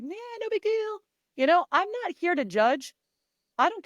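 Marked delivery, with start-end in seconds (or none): 0.0-1.0 high, offhand shrug of a line; mock nonchalance. Athletes pretending it's no big deal, high and casual. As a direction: brush it off like nothing's wrong. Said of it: "Everybody's sitting back like".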